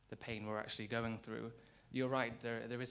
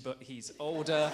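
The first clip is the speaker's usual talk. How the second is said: laughing while teasing; 8 semitones higher, 18 dB louder than usual. Real, not acted. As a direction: tease him through a laugh. Teasing colleague about age.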